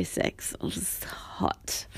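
slow hushed confession; whispering. Real, not acted. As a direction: lean in and whisper the secret. Confiding about private sex.

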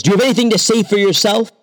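high, fast stern demand; demanding king. A narrator's reading. King interrogating the magician, high and fast. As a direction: fire the question at him.

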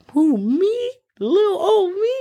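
very high, coy little voice; shy donkey. Retold or imitated. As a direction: squeak it out, playing bashful. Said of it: "the donkey's a little shy".

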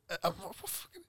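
breathless stunned whisper. Real, not acted. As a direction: whisper it in disbelief. Stunned disbelief.

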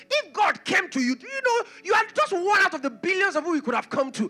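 high, loud, impassioned preaching; preaching at full force. Acted. Preacher urging the congregation to receive God's call by faith, impassioned preaching. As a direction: pound it out from the pulpit, rising to a cry.

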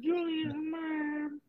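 high slow fake crying; mock wail. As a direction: stretch it out in a fake sob. Mock wailing.

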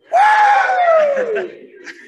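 big high burst of laughter; loud laugh. Real, not acted. Crowd cheering, laughs along.

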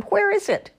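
very high hollered question; hollering. A narrator's reading. Old man demands an answer from the ghoul, high holler. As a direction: holler it, pitch leaping up. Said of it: "hollered the old man".

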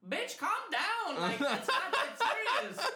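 hammed-up sass, drawn out; mock sassy voice. Server telling off an angry customer, mock sassy. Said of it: "And I was like".

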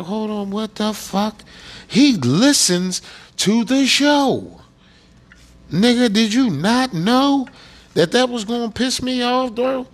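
high, incredulous outburst; retold disbelief. To a hometown listener who angered him, high incredulous scolding. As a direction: raise the pitch in baffled outrage. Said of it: "I'm like".

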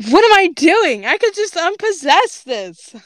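exclaiming. A narrator's reading. Grape suddenly realizes what she could do, exclaiming. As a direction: exclaim it high, pitch swooping up.

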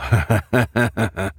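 taunting acted chuckle; a run of gloating villain laughter; villain's laugh. A narrator's reading. Lucas laughs at trapped women, gloating villain's laugh. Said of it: "he said, laughing".

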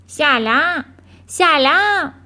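loud, high ringing bird call; same loud voice. A narrator's reading. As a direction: call out each greeting separately and strongly. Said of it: "بار دیگه همون صدا بلند شد".

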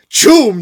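high, loud comic sound effect. Retold or imitated. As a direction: boom it out like a comic blast. Reads sound effect loudly.

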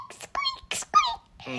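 very high mock baby squeak; squeaky little voice. Child imitating baby brother Lincoln, tiny squeaky voice. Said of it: "Lincoln talks in a little squeaky voice".

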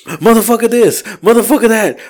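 high-pitched imitation of ranting; mock insults. Driver mimicking the insult video on the phone, high mock ranting. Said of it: "I hear nothing but insults".

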